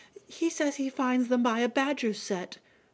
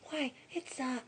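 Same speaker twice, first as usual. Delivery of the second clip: hushed; 9 dB quieter than usual. Acted. Hiding and watching a badger.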